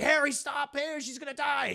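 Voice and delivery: high, loud mock-desperate shout; desperate plea. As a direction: yell to make him stop. Peter begging Harry to stop.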